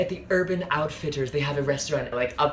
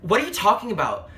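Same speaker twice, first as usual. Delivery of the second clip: higher, louder indignant comeback; outraged retort; 9 semitones higher, 3 dB louder than usual. Retold or imitated. Telling her date off, higher and louder. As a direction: fire it back, indignant.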